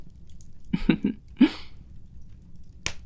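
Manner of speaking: a quiet, airy demon laugh; soft breathy chuckle. Acted. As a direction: chuckle under your breath, amused.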